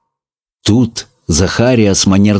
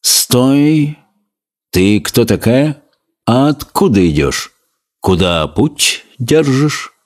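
sleepy lizard; 4 semitones higher, slower than usual. A narrator's reading. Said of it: "сонно, но деловито поинтересовался".

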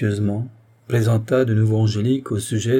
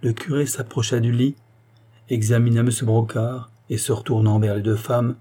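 ordinary delivery, nothing marked

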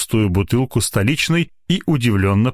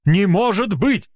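slow, much higher dismayed cry; heartfelt exclaim; 11 semitones higher than usual. A narrator's reading. Head doctor dismayed that the new doctor fled. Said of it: "в сердцах воскликнул он".

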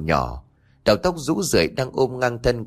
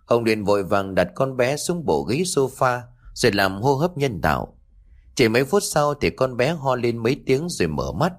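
usual speaking voice

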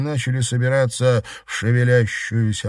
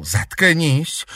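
raised-pitch angry order through gritted teeth; 6 semitones higher than usual. A narrator's reading. Enraged girl silencing a classmate, through gritted teeth. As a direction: force it out between the teeth. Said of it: "процедила Наташа".

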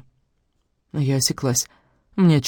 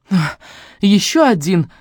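higher-pitched amused exclamation; 5 semitones higher, 4 dB louder, slower than usual. A narrator's reading. Sister laughing at brother's excuses, amused. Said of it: "рассмеялась я".

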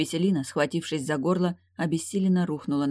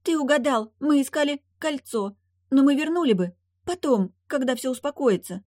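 high pleading maiden; 10 semitones higher, 3 dB louder than usual. A narrator's reading. Nepryadva pleading, voice raised.

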